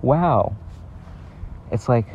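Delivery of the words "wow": a touch of wonder on wow; mock amazed wow. Shown as a demonstration. Vlogger explaining what an interjection means, mock amazement. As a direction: say wow as a mild reaction. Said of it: "It's kinda like saying".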